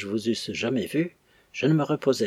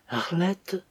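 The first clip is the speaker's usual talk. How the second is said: Arlette's name called higher, with a trembling voice; 8 semitones higher than usual. A narrator's reading. Old woman calls through the door, trembling. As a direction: quaver the name from behind the door. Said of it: "une voix tremblante prononcer le mot".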